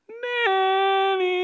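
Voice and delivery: put-on high, loud child's call from another room. Little daughter calling dad from bed, loud high call. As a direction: call out for daddy in the night.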